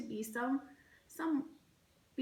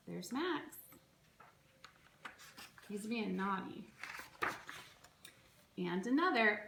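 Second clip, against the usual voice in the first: ordinary delivery, nothing marked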